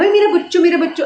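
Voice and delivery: high, sing-song pampering coo; mock a doting parent cooing over their child. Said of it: "जो माँ बाप अपने कहते हैं". (दुलार करते माँ-बाप की नकल)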